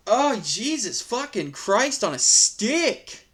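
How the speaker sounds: ordinary delivery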